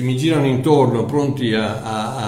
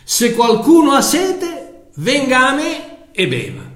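calling out; 10 semitones higher than usual. A narrator's reading. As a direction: cry it out across the festival crowd. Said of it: "gridò".

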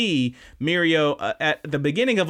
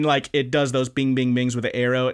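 usual speaking voice

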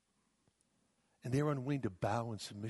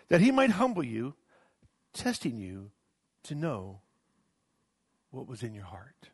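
scripture declaration; 5 dB louder than usual. Acted. Preacher reading Deuteronomy to the congregation, proclaiming then hushing.

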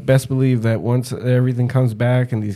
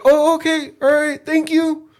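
much higher, slowing mock thanks; imitated polite fans. Fans thanking a cosplayer at the expo, high mock voice. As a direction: thank him in a high, hesitant voice.

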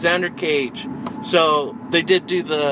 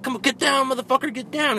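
high mock yell of a local guard; Latin dude yelling; 11 semitones higher, faster than usual. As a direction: holler it up high like a guard chasing him. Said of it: "fucking Latin dude's like".

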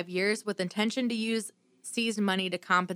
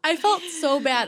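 laughing; 8 semitones higher, 4 dB louder than usual. Real, not acted. Laughs while recalling guilt.

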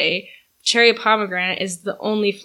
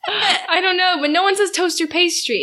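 tag; 8 semitones higher than usual. Real, not acted. Laughing after both accent attempts.

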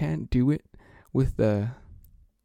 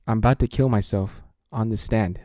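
usual speaking voice

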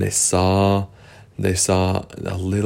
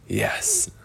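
whispered yes; 11 semitones higher, 8 dB quieter than usual. Acted. Whispered agreement.

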